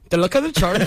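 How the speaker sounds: badly faked Irish leprechaun; bad Irish accent. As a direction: botch a mascot line in mock Irish. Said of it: "hear mine one more time".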